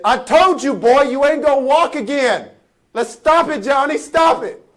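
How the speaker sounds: put-on high, loud telling-off of a child; mama scolding. Mama shuts down Johnny's hope, loud high scolding. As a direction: lay down the law as an exasperated mother.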